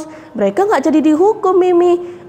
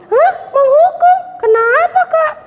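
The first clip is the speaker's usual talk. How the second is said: put-on surprised puppet girl; 12 semitones higher than usual. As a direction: gasp in childish alarm.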